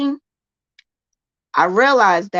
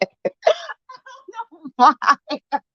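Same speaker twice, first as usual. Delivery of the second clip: laughing at herself; 8 semitones higher than usual. Real, not acted. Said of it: "I don't know why I'm talking like that".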